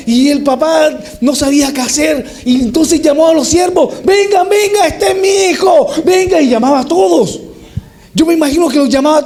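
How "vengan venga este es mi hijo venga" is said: very high, excited summons; calling servants. Acted. Father calls servants to see his son, high joyful shout. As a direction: gather everyone, bursting with joy.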